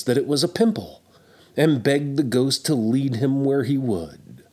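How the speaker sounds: usual speaking voice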